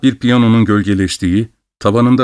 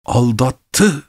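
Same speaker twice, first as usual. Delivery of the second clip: "Aldattı" with the last syllable "tı" leaned on and raised; stress on final TI; 3 semitones higher than usual. A narrator's reading. Betrayed husband to the doctor, "Aldattı" cried with "tı" stressed. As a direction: cry "Aldattı", pushing hard on "tı" without much volume. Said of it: "hecesinin üzerine basarak, ... diye bağırdı".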